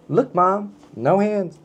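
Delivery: put-on high little-kid voice; childlike show-off. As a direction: squeak it out like a kid proud of a trick. Book voiced as a showing-off child.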